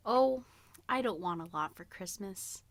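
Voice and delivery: ordinary delivery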